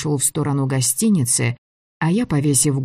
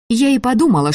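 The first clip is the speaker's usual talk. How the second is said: higher-pitched, heated comeback; angry retort; 9 semitones higher, 4 dB louder, faster than usual. A narrator's reading. Girl answering the director's scolding, angry retort. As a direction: snap back defensively, pitch up. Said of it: "Злость бушевала во мне".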